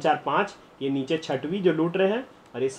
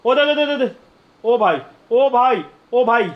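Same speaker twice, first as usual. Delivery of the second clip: stunned shout; 7 semitones higher, 5 dB louder than usual. Real, not acted. Streamer shouting at the screen as a squad gets wiped, loud and high. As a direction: call it out like you can't believe it. Watching a sudden squad wipe.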